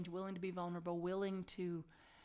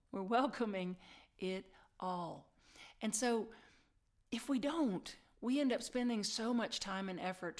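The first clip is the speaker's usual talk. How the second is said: ordinary talk, nothing marked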